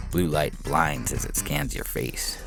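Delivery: plain reading voice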